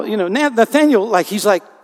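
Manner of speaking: normal talking voice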